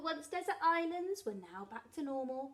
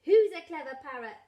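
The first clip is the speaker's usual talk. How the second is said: coaxing praise; 4 semitones higher, 11 dB louder than usual. Acted. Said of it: "Polly copied".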